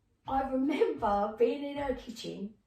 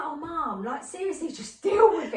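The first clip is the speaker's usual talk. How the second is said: high, loud, laughing exasperation; fed-up son rolling his eyes at mum; exasperated son; 6 semitones higher, 9 dB louder than usual. Retold or imitated. Son telling his mother to just accept it, exasperated.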